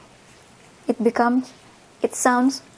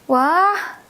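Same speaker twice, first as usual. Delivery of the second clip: surprised wah; 4 semitones higher than usual. Shown as a demonstration. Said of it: "we'll be continue with".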